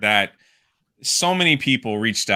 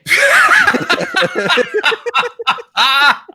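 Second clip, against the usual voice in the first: loud, high burst of laughter; long cackling laugh, out loud; real big laugh; 7 dB louder than usual. Real, not acted. Streamer tickled by friend's big promise, laughing hard.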